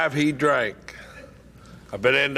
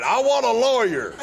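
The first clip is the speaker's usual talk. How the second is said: hollering; 7 semitones higher than usual. Retold or imitated. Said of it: "I'm hollering all night".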